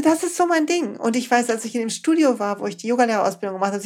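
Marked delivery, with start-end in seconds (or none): 0.0-0.9 higher-pitched, excited inner voice; delighted realization. Retold or imitated. Speaker recalls her first workshop, delighted realization.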